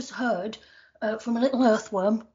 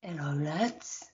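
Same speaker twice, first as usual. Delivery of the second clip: Statman John voice; 5 semitones lower, 8 dB quieter, slower than usual. Acted. Character Statman John enters.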